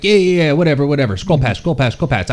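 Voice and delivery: loud, high, impatient dismissal; dismissive brush-off. Retold or imitated. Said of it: "And you're like".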